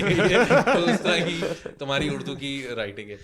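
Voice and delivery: talking through a laugh. Real, not acted. Retelling mother's joke about his messy handwriting, laughing.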